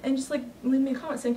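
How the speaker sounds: ordinary talk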